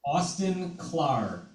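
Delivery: name spelled out slowly; slow and clear. Real, not acted. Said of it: "Rabbi, can you repeat his name?".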